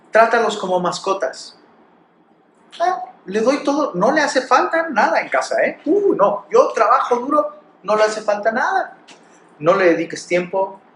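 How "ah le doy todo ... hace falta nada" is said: smug father. Retold or imitated. Self-satisfied father mocked.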